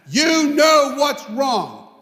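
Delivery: slow angry repeat. Retold or imitated. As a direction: spell it out angrily. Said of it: "He was that mad".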